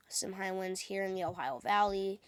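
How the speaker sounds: ordinary talk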